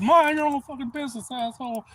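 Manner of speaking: high, loud, rude brush-off; angry yell. Retold or imitated. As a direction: bark at a nosy stranger to back off. Telling off a nosy passerby.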